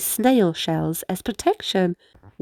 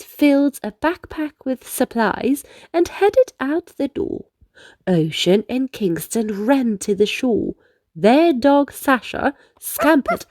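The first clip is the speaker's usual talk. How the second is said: usual speaking voice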